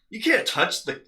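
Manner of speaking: high, loud indignant yell; retold protest. Protesting at being shoved away, retold shouting. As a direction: protest at full pitch, imitating outrage.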